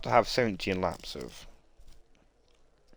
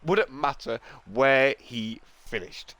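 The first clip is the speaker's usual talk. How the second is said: race commentary; 11 semitones higher than usual. Acted. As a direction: call the race live, full energy. Live race play-by-play.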